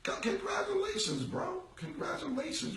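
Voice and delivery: high, sarcastic congratulations; mock congratulations. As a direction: congratulate him with heavy sarcasm.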